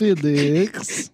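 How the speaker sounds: mock Russian accent. Shown as a demonstration. Mock accent game continues.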